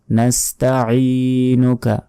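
ordinary talk, nothing marked

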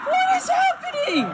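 high, loud shrieking question; panicked scream. Real, not acted. Player alone in the dark, shrieking in panic.

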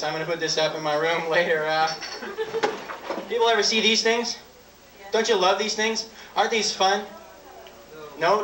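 usual speaking voice

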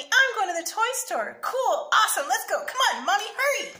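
put-on high, loud, thrilled little boy; loud kid bursting with excitement; excited yell. A narrator's reading. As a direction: yell it eagerly, hurrying Mom along. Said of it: "yelled Sammy".

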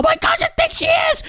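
high shrieking alarm; panicked shout. Acted. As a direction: shriek as she's spotted. Mom coming up stairs.

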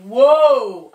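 loud burst of wonder; amazed whoa. Acted. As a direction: gasp as you sprout. Seedling sprouting.

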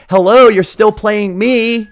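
drawn-out "hello?" of a jilted game; indignant reminder. Acted. Neglected game scolding its distracted player, mock indignant. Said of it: "Gauntlet two's looking at me like".

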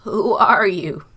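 put-on loud, trembling old man's question; shaking voice. A narrator's reading. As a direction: ask in alarm with the voice quivering. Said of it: "In a shaking voice he asked".

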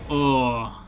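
ordinary delivery

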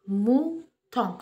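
"Mouton" slowly cut into two beats, Mou, ton; split into syllables. Shown as a demonstration. Teacher shows children how mouton divides, "Mouton" syllable by syllable. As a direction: break "Mouton" after "Mou", drawing out each syllable. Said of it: "comment est-il découpé".

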